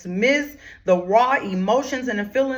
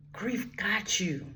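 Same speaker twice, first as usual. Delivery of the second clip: hushed whisper; 9 dB quieter, slower than usual. Acted. Speaker to grieving listeners, a slow whisper.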